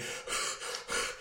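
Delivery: puffing hard, as if tiring; mimed heavy breathing. Retold or imitated. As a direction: act out getting winded mid-fight. Mimics tiring out in a fight.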